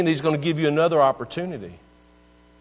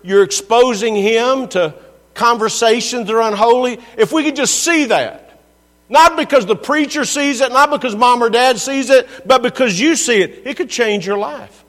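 raised, ringing preacher voice, higher and louder; preaching at full force; 7 semitones higher, 7 dB louder than usual. Acted. Pastor urging the congregation, loud, high preaching. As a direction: drive it home to the congregation.

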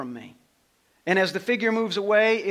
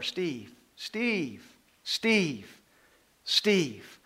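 each Steve a little louder than the last; calling, growing louder; much slower than usual. Retold or imitated. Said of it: "my name is louder".

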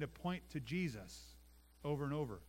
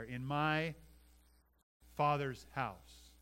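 drawn out very slowly, "Father's" weighted; slow emphatic answer; much slower than usual. Shown as a demonstration. Pastor revealing Jesus meant God, slow emphatic. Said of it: "to be where?".

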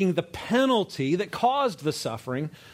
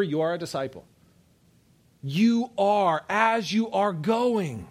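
rising, louder and higher preaching; preaching at full force; 6 semitones higher, 3 dB louder than usual. Acted. Preacher urging the congregation that everyone must be going, preaching hard. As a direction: build the fire, hammering the point home. Preacher drives point home again.

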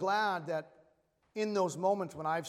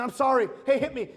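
loud apology; 4 semitones higher, 9 dB louder than usual. Retold or imitated. Retold apology to his friend.

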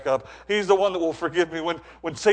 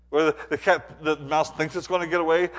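ordinary delivery

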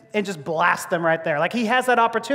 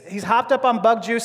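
usual speaking voice

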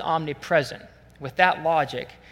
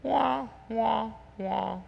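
soft mock "wah, wah, wah" of failure; sad trombone imitation; 5 semitones higher, 7 dB quieter, much slower than usual. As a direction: voice a comic letdown horn. Imitated sad trombone after rebuke.

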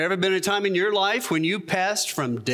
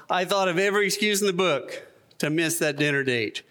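usual speaking voice

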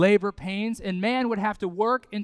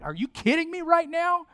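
exasperated disbelief; 9 semitones higher than usual. Retold or imitated. Dad reacts to child's reminder.

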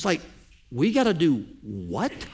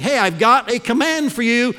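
high, loud call for attention; announcing loudly to get everyone listening; 6 semitones higher, 7 dB louder than usual. Retold or imitated. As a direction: grab the crowd's ears before the command. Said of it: "the emphasis should be at the beginning".

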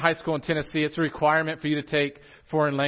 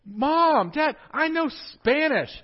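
put-on kid boasting; 11 semitones higher, slower than usual. Student tells Mom and Dad, high and excited. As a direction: show off to your parents. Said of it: "you would go home and you would say".

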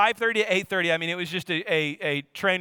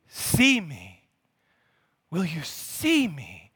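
gentle plea; much slower than usual. Acted. Preacher voices Jesus pleading.

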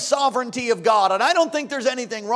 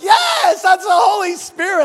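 high, loud joyful yell; excited shout; 8 semitones higher, 9 dB louder than usual. Retold or imitated. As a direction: shout it in delight. Pastor's excited reply retold.

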